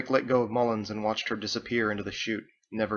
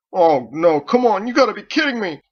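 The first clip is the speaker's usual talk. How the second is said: panicked plea; 10 semitones higher, 11 dB louder than usual. Acted. Fritz facing execution.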